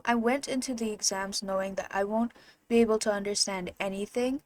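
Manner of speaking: ordinary talk, nothing marked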